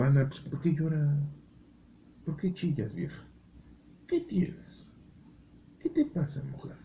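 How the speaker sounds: high, mocking husband voice dropping to a whisper; husband Elcana asking. Elcana asks crying Ana why she weeps, high and hushed. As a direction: tease her like a pestering husband. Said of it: "le decía el Cana".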